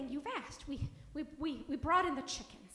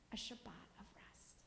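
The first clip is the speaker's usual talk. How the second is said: whisper; 6 semitones lower, 16 dB quieter than usual. A narrator's reading. Story's peaceful closing line.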